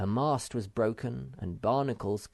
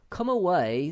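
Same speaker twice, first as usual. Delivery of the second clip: urgent plea; 9 semitones higher, 6 dB louder than usual. A narrator's reading. Moomin Troll urges leaving wreck.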